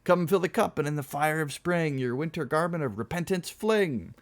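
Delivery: high, declaimed verse; crying out. Acted. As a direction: cry out the poem like a toast. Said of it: "cried the cremator".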